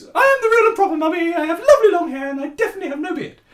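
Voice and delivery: a dad announcing himself as the comic mum; hammed-up fast high mummy introduction; silly put-on lady voice. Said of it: "affected a stupid female accent".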